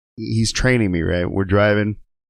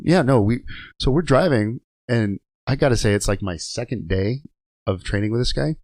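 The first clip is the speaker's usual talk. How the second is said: normal talking voice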